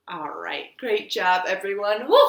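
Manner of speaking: ordinary delivery, nothing marked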